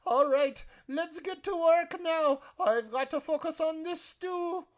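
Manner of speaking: very high, loud character voice; rally the kitchen in a loud falsetto; bustling kindly cook. Acted. Cook getting everyone back to work, bustling high voice.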